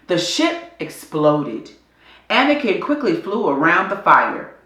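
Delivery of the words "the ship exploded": ordinary delivery, nothing marked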